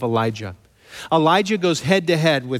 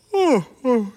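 mock sigh; 9 semitones higher than usual. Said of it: "he's sitting there like".

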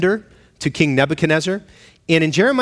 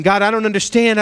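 pleading complaint; 6 semitones higher than usual. Acted. Habakkuk complains to God.

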